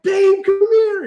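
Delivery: high, loud, slow holler; excited calling out. Retold or imitated. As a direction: call your partner over, thrilled. Calls partner over in excitement.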